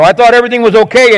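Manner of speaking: high, fast retold protest of disbelief; distressed disbelief. Acts out another deserted spouse.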